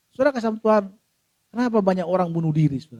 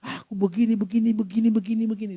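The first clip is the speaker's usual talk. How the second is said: slightly raised, repetitive babble; mock endless chatter; 3 semitones higher than usual. Person venting without pause to a listener, mock chattering. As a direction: lightly imitate someone rattling on about troubles. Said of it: "kita ngomongan terus".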